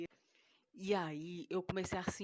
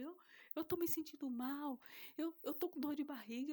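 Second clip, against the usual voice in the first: feigned weakness; 8 semitones higher than usual. Retold or imitated. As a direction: play sick to make him pity you. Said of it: "falava com ele". (voz fraca e chorosa fingindo estar mal)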